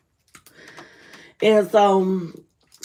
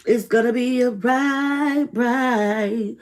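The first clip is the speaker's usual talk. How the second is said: reassuring; 4 semitones higher, 4 dB louder, slower than usual. Real, not acted. Reassuring viewers.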